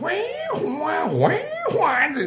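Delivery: high wailing siren going up and down; rising and falling siren imitation. Shown as a demonstration. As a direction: imitate a wavering air-raid siren. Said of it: "上がったり下がったりする". (上下するサイレンの物真似)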